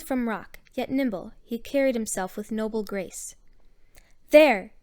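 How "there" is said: sudden loud exclamation; abrupt, forceful interjection; interrupting. A narrator's reading. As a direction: cut in with one loud word. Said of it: "he interrupted".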